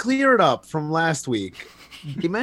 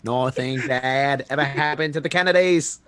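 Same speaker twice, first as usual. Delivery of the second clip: slow, hammed-up Australian-Kennedy accent; [name] JFK-Australian voice. Teasing co-host with his impression, JFK-like Australian accent. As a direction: drag it out in a mock Boston-tinged Australian. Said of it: "[name] J F K Australian voice".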